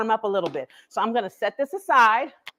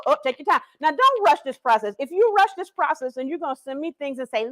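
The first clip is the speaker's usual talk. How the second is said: usual speaking voice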